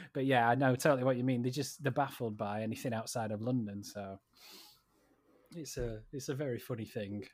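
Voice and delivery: ordinary talk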